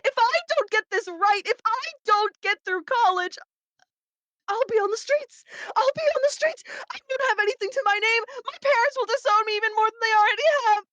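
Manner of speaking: high, tearful outburst of panic; desperate pleading. Acted. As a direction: plead as if your life depends on it. Younger self pleads desperately with demon.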